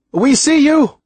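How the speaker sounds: high-pitched, loud answering shout; shout across water. A narrator's reading. Man on the boat answers the stranded boy, shouting. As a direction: shout back over a distance. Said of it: "There was a shout from across the water".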